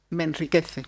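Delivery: ordinary talk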